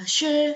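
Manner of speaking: SHE high and level; first tone. Shown as a demonstration. Teacher giving the first example, high level tone. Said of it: "yang pertama tadi".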